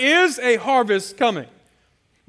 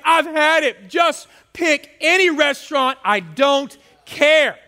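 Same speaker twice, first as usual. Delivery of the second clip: fed-up wife; 6 semitones higher, 4 dB louder, slower than usual. Retold or imitated. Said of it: "She's like".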